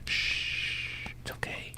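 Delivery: hushed, soothing whisper; mock soothing whisper to a friend. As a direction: whisper him down like calming a child. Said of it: "Calm down".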